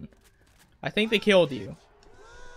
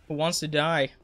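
normal talking voice

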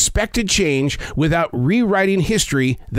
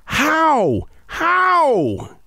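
high, long-stretched wail of HOW; HOW as a big swooping cry; huge drawn-out how; 10 semitones higher than usual. Acted. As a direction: stretch HOW out high, then let it fall. Reading out an anxious huge "how.

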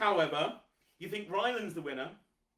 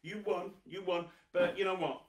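usual speaking voice

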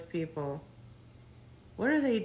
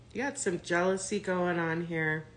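normal talking voice

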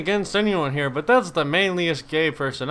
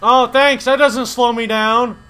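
loud, high mock thanks; sarcastic yell; 7 semitones higher, 9 dB louder, slower than usual. Real, not acted. Gamer slowed down by the game, sarcastic yell.

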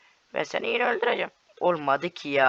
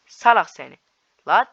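loud insult; 10 dB louder than usual. Real, not acted. Insulting an opponent.

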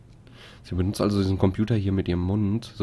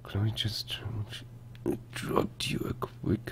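whisper; 11 dB quieter, much slower than usual. Retold or imitated. Reading game text.

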